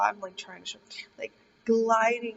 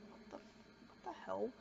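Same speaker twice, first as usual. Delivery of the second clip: startled whisper; 17 dB quieter than usual. Retold or imitated. Startled by a noise in dream.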